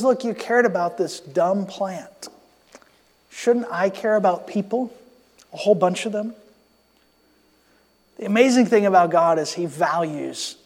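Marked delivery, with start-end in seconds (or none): 0.0-6.3 much softer, tender reasoning; gentle paraphrase of God. Retold or imitated. God answers angry Jonah about the plant, softly and tenderly. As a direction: gently reason with a sulking prophet. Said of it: "God says".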